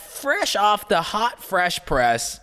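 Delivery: slow, high announcer voice; drawn-out news anchor intro; morning news anchor. Pretend. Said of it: "Welcome to the We Out Here morning news".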